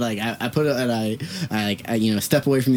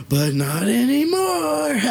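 much higher, slow villain line with a laugh in it; 12 semitones higher, much slower than usual. Retold or imitated. Stranger at the urinal taunting him, slow laughing villain voice. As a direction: stretch it out like a cartoon villain, laughing. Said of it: "start laughing like a villain".

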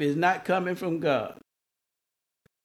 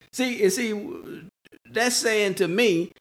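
ordinary talk, nothing marked

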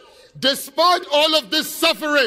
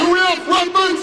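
raised, higher call to the crowd; rallying shout; 6 semitones higher, 4 dB louder than usual. Real, not acted. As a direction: work the crowd with a shouted question.

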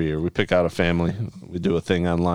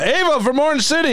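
announcer voice; 13 semitones higher than usual. Acted. Host reading a listener's name, hammed-up announcer call.

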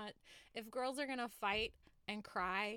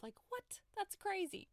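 incredulous; 10 semitones higher than usual. Real, not acted. Incredulous at suicide plot.

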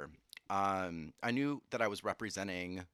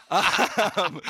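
laughing; 8 semitones higher, 8 dB louder than usual. Real, not acted. Laughs after no losses.

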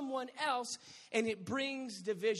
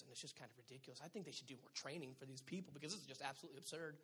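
much softer, lower complaining voice; gossiping mom; 9 semitones lower, 13 dB quieter, faster than usual. Retold or imitated. Moms at the pickup line gossiping about a teacher, soft and catty. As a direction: complain about the teacher behind her back.

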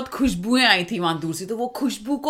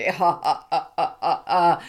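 low villain's mock cackle; evil laugh; 3 semitones lower, much slower than usual. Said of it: "वो हंस रहा था कि".

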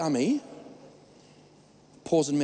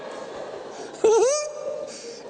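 high laugh. Real, not acted. After mock shout.

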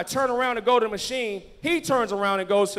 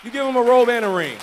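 loud, higher preaching shout; ringing exhortation, raised pitch and force; emphatic repeat; 4 semitones higher, 12 dB louder than usual. Real, not acted. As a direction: proclaim it at full pulpit volume. Repeats line for the congregation.

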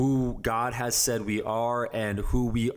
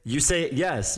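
ordinary talk

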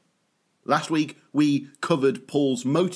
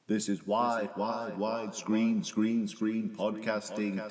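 mock echo effect; 5 semitones lower, 7 dB quieter than usual. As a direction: lower and much softer, words repeating like a fading echo. Host's mock echo on catchphrase.

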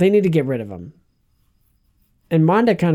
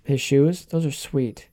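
usual speaking voice

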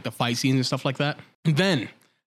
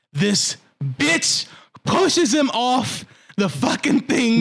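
slow, drawn-out disbelief, pitch climbing; outraged emphasis; 8 semitones higher, much slower than usual. Real, not acted. Podcaster reveals the betrayal to co-hosts, slow outraged emphasis. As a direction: land each word heavily, stretched out.

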